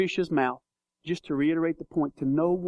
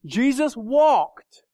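walked stretched, louder and slow; emphatic, slow; 5 semitones higher, 5 dB louder, much slower than usual. Real, not acted. Preacher stressing Jesus walked, slow and louder. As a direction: lean hard on walked. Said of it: "here's what I want you to get".

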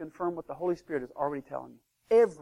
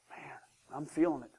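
breathy unvoiced whisper of temptation; hushed, half-convinced whisper; tempted whisper; 7 dB quieter than usual. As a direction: whisper it like you're being drawn in. Listener tempted by false word.

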